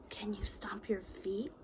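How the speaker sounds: soft secret whisper. Shown as a demonstration. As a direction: whisper the question playfully. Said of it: "whispered question to children".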